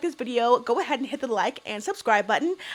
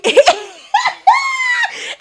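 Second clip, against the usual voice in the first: screaming high laugh; 8 dB louder than usual. Real, not acted. Bursts out laughing.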